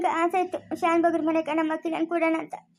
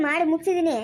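usual speaking voice

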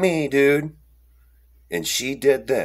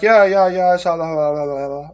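absent-minded chatter. Retold or imitated. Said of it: "they're not really there".

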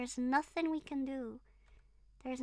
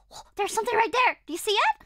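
excited spotting; 9 semitones higher, 10 dB louder than usual. Real, not acted. Spotting something in game.

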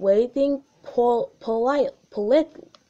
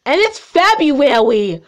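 high, loud indignant cry; much higher, louder mock-outraged exclamation; 6 semitones higher, 11 dB louder than usual. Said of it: "outraged addition".

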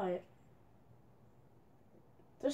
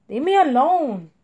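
much louder shouted demand; real shout at the teammate to back off; angry shout; 10 dB louder than usual. Real, not acted. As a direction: yell it to make him stop.

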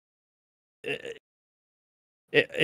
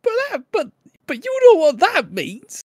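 squeaky high voice; 5 dB louder than usual. Pretend.

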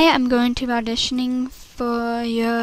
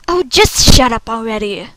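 louder, fed-up snap; annoyed outburst; 2 semitones lower, 6 dB louder than usual. Acted. Audition takes, annoyed outburst.

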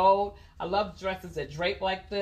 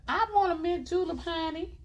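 Southern belle; 11 semitones higher than usual. Acted. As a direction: play up a sweet Southern belle, pitched way up. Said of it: "I'm doing my Southern Belle voice".

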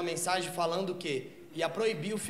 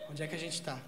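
hushed spacey question; 6 semitones lower, 10 dB quieter than usual. Retold or imitated. Stoned woman asks dreamily.

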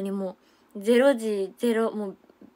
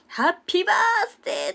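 high, louder cheer; loud birthday shout; 12 semitones higher, 5 dB louder than usual. Retold or imitated. Imitating a member loudly singing happy birthday. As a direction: belt out the birthday wish. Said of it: "大きな声で歌われて".

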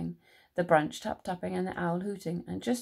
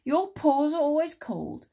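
raised, louder grumble; complaining Big Mouse; 8 semitones higher, 6 dB louder than usual. A narrator's reading. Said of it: "said Big Mouse".

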